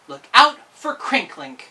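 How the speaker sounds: loud, slow warning sign; sign read out. A narrator's reading. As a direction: call out the warning slowly. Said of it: "a sign tacked to a tree which said".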